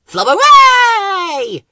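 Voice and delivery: very high, loud, long-held cheer; squealed silly hooray; excited cry. A narrator's reading. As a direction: cheer with a stretched last syllable. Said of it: "cried Ben".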